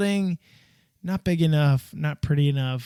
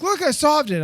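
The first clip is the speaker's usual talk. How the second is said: put-on excited kid; 10 semitones higher, 4 dB louder than usual. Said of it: "he was like".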